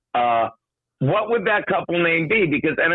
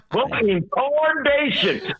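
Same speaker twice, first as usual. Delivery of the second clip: high, slow cowboy drawl; cartoon cowboy voice; 7 semitones higher, slower than usual. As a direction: try out the cowboy line. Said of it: "Pretty good".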